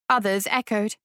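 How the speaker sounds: ordinary delivery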